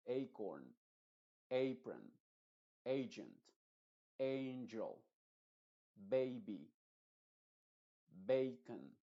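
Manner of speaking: put-on "acorn" "apron" "baby" said with the long English A, each word alone and slow. Shown as a demonstration. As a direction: read each word out clearly with the A sound as "ei". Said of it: "Lo que me importa es que la A lo pronuncies como A".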